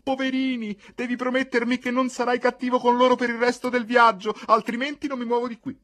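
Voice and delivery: very high, loud, insistent appeal; shrieked pleading. A narrator's reading. Mercedes to Al for the dogs, shrieked pleading. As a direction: plead and threaten at full pitch. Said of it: "intervenne Mercedes gridando".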